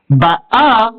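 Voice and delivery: Ba'a stressed and held on the final A; stress on last syllable. Shown as a demonstration. Teacher reads the sign under Aleph, final-stressed Ba'a. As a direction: land on the second syllable of Ba'a. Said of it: "c'est la dernière syllabe qui est".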